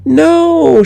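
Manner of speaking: put-on high, pleading little girl; a child's high plea; begging child. A narrator's reading. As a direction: beg like a small child. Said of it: "she begged".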